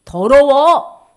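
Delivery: a sudden, slow shout of disgust, as if spoken by God. Acted. God rebukes impure worship.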